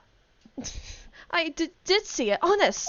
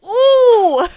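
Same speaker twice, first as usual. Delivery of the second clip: excited squeal; 8 semitones higher, 12 dB louder, much slower than usual. Acted. Hammed-up squeal over Phoenix.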